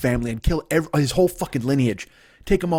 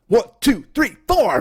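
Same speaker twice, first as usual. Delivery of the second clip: higher, louder rock count-in; mock count-in; 9 semitones higher, 5 dB louder, slower than usual. Father's advice to Sean, a loud rock count-in. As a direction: count it in like a band starting. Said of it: "Here's what he said".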